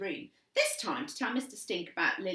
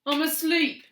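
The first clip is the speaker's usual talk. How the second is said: muffled cry; 10 semitones higher, 11 dB louder than usual. A narrator's reading. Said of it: "came the muffled cry".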